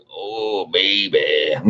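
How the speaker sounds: a hint of a Delta blues crooner. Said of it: "be like [name]".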